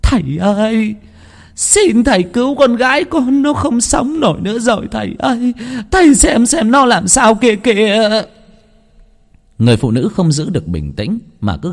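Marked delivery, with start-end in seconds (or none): 0.0-8.2 high, wailing plea; wailing mother. Acted. Mother wails to the healer for her daughter, high pleading. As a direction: beg the healer through sobs. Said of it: "mà gào khóc".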